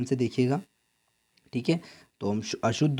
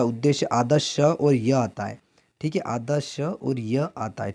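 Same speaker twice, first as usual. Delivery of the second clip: slow; slower than usual. Shown as a demonstration. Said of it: "teacher spells out half sha".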